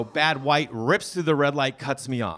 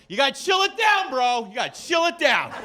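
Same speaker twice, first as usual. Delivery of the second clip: high shouted dude-speak; mock calm bro; 11 semitones higher than usual. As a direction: taunt an angry driver with surfer calm. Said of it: "I go".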